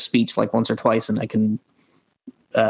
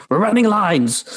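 high, raised mock yell; imitated yell; 9 semitones higher than usual.

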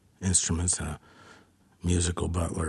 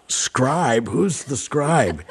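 higher-pitched, puzzled query; baffled retold question; 6 semitones higher, much slower than usual. Musician wondering who is being summoned, baffled. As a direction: ask it as if looking around for someone. Retelling his puzzled look around.

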